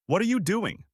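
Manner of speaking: alarmed high cry. A narrator's reading. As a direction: blurt it out, alarmed. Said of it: "she cried".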